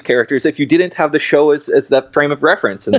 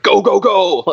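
hammed-up movie-hero urging, higher pitched; mock shouting; 7 semitones higher, slower than usual. Co-host imitating Matthew Broderick shouting, half-hearted mock shout. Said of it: "Matthew Broderick shouting".

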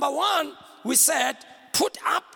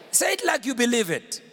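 ordinary talk, nothing marked

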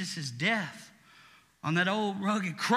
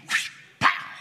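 mock smack sound; 6 dB louder than usual. Imitated spanking sound.

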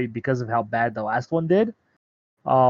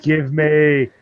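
louder, insistent demand; mock demand; 2 semitones higher, 5 dB louder than usual. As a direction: push it out like an order. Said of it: "want me to scream and yell it?".

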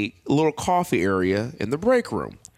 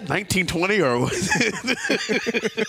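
laughing through words; 10 semitones higher than usual. Real, not acted. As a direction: try to ask while cracking up.

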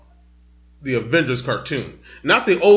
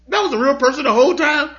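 put-on a small boy in total disbelief; kid voice; 11 semitones higher than usual. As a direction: son asking dad about Scooby-Doo, high kid voice. Retelling son's disbelief.

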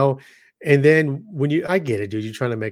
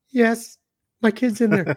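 high-pitched imitation of a scared woman; mock crying girl; 10 semitones higher than usual. Imitating the frightened mother answering the hero, high and pleading.

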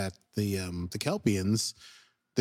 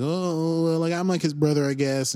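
timid guy; 6 semitones higher, 4 dB louder than usual. Retold or imitated. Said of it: "some timid guy's like".

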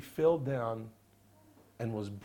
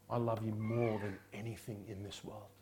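softer, lower heartfelt reply; tender confession; 4 semitones lower, 7 dB quieter than usual. Acted. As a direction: say it quietly from the heart. Peter answering Jesus.

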